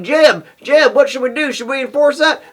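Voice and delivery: mocking school officials. Vlogger mocks school staff debating the rules, high mock voice. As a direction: play the clueless administrator, high and silly.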